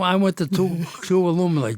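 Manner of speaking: loud, high and eager; excited. Real, not acted. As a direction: jump in eagerly with a joke. Joke about reunions.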